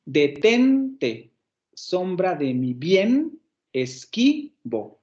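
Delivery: "Detente, sombra de mi bien, esquivo" slowly, leaning on TEN, BIEN and QUI; "bien" drawn out and rising, "esquivo" slow and falling; stressed syllables. Shown as a demonstration. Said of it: "Detente en la segunda sílaba".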